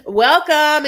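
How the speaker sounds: high, loud imitation of a welcome; mock party-host greeting. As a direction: call it out like a host.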